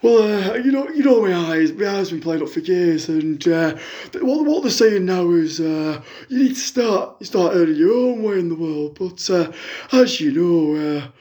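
a lightly put-on middle-aged fan on the phone; a fan phoning in with a sob story, lightly voiced; Jackie caller voice. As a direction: play the hard-up caller, chatty and woeful. Continues as Jackie.

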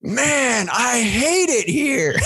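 high, slow weary grumble; fed-up guy. Retold or imitated. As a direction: sigh it out, fed up and high-pitched. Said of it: "is just like".

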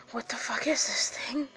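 hushed whisper of disbelief; whispered to self. Retold or imitated. Whispering to himself as the roach stares him down. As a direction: breathe it out under your breath. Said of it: "and I was like".

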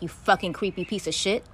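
ordinary talk

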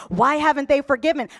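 usual speaking voice

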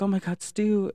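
Sydney startled as Stu appears; mock shock.

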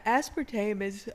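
speaking through a yawn; slow, stretched yawning words. Real, not acted. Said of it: "sorry, I keep yawning".

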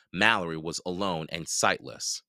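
ordinary delivery, nothing marked

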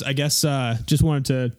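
normal talking voice

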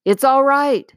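a lion's glad reassuring shout; joyous shout. Acted. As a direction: call out gladly to reassure. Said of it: "shouted Aslan joyously".

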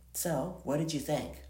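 plain reading voice

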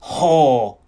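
loud, enraged bark; forceful angry shout; furious insult. A narrator's reading. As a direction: spit out the insult loudly. Man raging, shaking her.

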